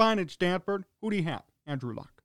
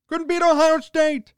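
exasperated rant; 8 semitones higher, 7 dB louder than usual. Real, not acted. Ranting at Harbaugh's record.

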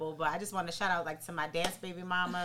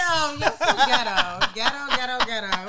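laughing tease; 4 semitones higher, 8 dB louder than usual. Real, not acted. Teasing friend about phone watching.

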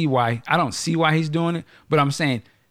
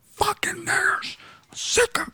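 high hissed whisper of a bigot; 3 dB quieter, slower than usual. Retold or imitated. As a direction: whisper the slur pitched way up, slow. Imitating racist ranting in secret.

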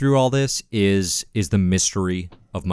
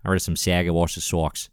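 lower, hammed-up East Coast accent; Boston accent; 5 semitones lower, 4 dB quieter than usual. Co-host keeps the Saga joke going, mock Boston accent. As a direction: put on a mock Boston sports-fan voice. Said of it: "that sounds a little Boston".

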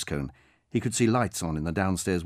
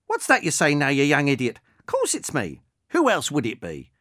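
put-on higher and louder, a snappish elderly voice; testy old woman; 4 semitones higher, 5 dB louder than usual. Old woman scoffing at the young idiot, testy. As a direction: brush off the silly question.

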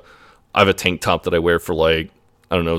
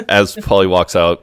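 ordinary talk

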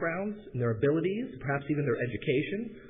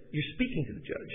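higher, softer Southern voice; southern accent; 9 semitones higher, 5 dB quieter than usual. Retold or imitated. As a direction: reveal it softly in a Southern accent. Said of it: "they spoke with a southern accent".

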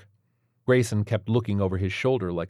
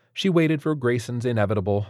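usual speaking voice

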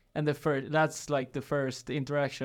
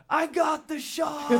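much higher and slow, thrilled cry; excited imitation; 11 semitones higher, 3 dB louder, slower than usual. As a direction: mimic a friend thrilled at his phone. Friend imitates him on phone.

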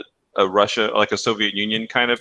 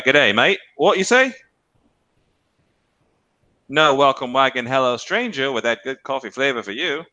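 a light, hammed-up Australian accent, pitched up; mock Australian accent; 5 semitones higher than usual. Joking to his cohost, an Aussie local in a mock Australian accent. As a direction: play a jokey Aussie local greeting a stranger. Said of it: "they say it in an Australian way".

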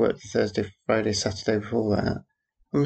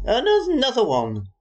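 mock dismay; 13 semitones higher, 4 dB louder than usual. Mock despair at passing cars.

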